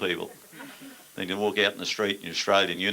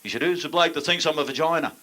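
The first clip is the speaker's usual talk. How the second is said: higher, louder mock drill sergeant scream; 8 semitones higher, 3 dB louder than usual. Sergeant demands the culprit from recruits, mock yell. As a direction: roar it at the whole parade ground. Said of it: "this sergeant screamed out".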